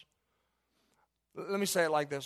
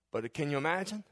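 normal talking voice

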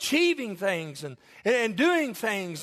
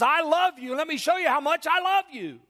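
high, raised preaching voice as Jesus; impassioned declaration; 7 semitones higher than usual. Acted. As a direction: cry it out as a fervent pledge of love.

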